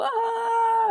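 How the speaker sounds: very high short scream; movie scream. Acted. Zombie movie victims on screen, high short scream. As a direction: shriek like a horror extra.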